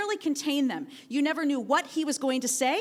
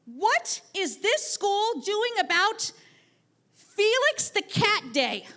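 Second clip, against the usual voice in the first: slow, higher, louder insistence; demanding; 8 semitones higher, 4 dB louder, slower than usual. Retold or imitated. Student demanding answers from his teacher, slow and insistent. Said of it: "Jacob demanded to know".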